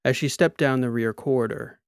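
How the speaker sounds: ordinary delivery, nothing marked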